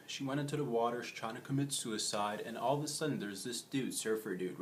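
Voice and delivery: usual speaking voice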